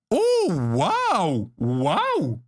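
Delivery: long, swooping wows of awe; amazed wow. Real, not acted. Streamer pulling a special card, amazed. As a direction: draw out each wow, pitch soaring then dropping.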